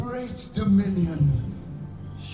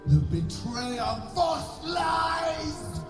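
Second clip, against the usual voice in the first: furious shout, slow and higher; 8 semitones higher, slower than usual. Acted. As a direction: roar the accusation. Game villain denounces traitor.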